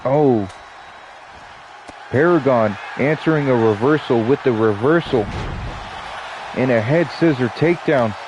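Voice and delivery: lively ringside announcer calling the action; play-by-play commentary. Acted. Streamer calling the wrestling match for viewers, announcer style. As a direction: call the match like a wrestling commentator, stretching the names.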